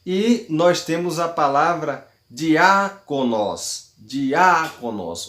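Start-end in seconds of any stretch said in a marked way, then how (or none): 2.3-5.3 diáconos, slow, with a stretched Á; stress on Á. Shown as a demonstration. Teacher demonstrating the accent of diáconos, slow stress on Á. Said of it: "O acento tá no A".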